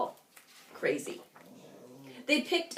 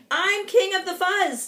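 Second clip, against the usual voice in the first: mock snarl; 8 semitones higher, 5 dB louder than usual. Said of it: "he snarled".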